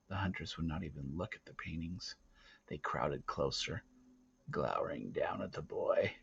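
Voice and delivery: plain reading voice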